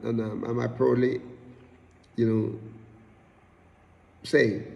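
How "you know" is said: ordinary talk, nothing marked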